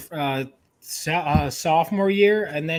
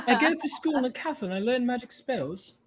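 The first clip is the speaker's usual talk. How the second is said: higher, put-on British accent; fake British accent; 4 semitones higher, 4 dB quieter than usual. Shown as a demonstration. Friend takes a turn at the test line, fake British accent. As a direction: show off a practised British accent. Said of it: "Fake British".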